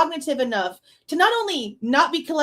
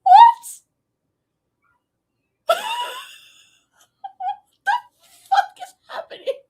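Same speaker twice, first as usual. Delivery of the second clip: stunned what; 5 dB louder than usual. Real, not acted. Stunned by what she hears.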